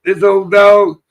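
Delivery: a hammed-up sweet voice to a dog; quoting the cop. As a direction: imitate the friend talking sweetly to the dog. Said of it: "He said".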